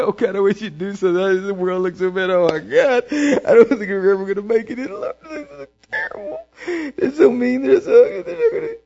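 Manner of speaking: hammed-up despairing moan; mock whining complaint. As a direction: play a hand-wringing doubter near tears. Doubter complaining to God.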